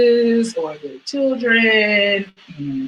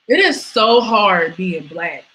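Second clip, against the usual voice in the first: normal talking voice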